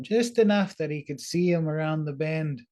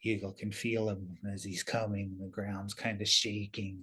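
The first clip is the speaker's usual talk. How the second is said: plain reading voice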